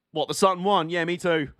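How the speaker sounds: usual speaking voice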